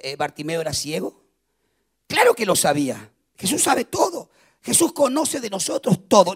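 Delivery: ordinary delivery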